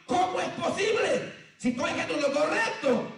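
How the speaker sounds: preacher mimics a believer crying out why; exasperated cry. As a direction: cry out in disbelief at God. Preacher voices a complaint to God.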